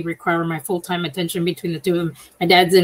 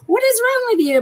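sharp, much higher outburst at her mother; high-pitched frustrated cry; exasperated. Retold or imitated. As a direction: snap out a baffled what is wrong. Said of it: "And I'm like".

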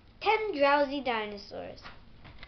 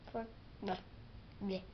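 silly bleh; 6 semitones lower, 14 dB quieter, faster than usual. Real, not acted. Reader stumbles, blows raspberry.

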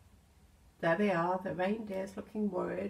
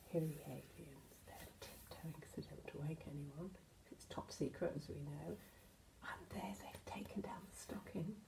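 hushed whisper; secretive bedtime whisper; tiptoe whisper; 3 semitones lower, 13 dB quieter than usual. Acted. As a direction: whisper so nobody wakes. Aliens tiptoe, don't wake anyone.

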